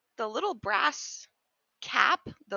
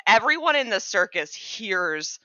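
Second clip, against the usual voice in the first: normal talking voice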